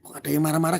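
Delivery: ordinary talk, nothing marked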